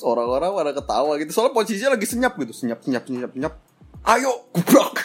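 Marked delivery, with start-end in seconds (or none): 4.0-5.0 playful crash sound. Retold or imitated. Acting out the push and crash.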